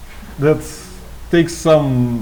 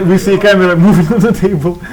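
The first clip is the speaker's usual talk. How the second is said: laughing; 5 semitones higher, 6 dB louder, faster than usual. Real, not acted. Laughing at camera on table.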